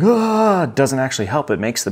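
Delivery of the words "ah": a high, loud mock wail like a hurt kid; mock crying wail. Parent imitating a kid whining over a scrape, mock wail. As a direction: ham up a child's whiny cry for a split second. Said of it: "the crying and getting all, like".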